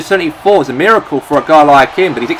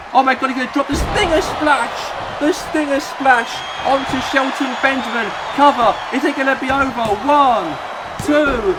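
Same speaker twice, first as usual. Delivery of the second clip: excited commentator; 7 semitones higher than usual. Acted. Near-fall excitement in commentary.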